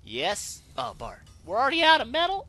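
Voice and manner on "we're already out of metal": high, loud disbelieving yelp; sudden shock at empty stock; squeaky high burst of dismay; shocked outcry. Real, not acted. Game loot shock outcry.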